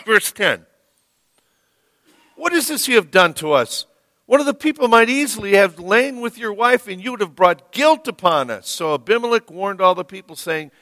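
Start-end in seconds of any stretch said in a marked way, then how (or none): 2.4-8.7 fast, a touch louder reproach; reading an angry king's rebuke. Retold or imitated. Pastor reading the king's rebuke from verse ten, indignant. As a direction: read it as an indignant king. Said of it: "this Philistine king is angry".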